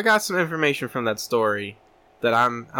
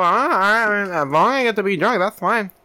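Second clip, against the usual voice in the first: mock Dale; 7 semitones higher than usual. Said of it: "And I'm like".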